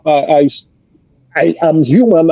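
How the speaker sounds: ordinary delivery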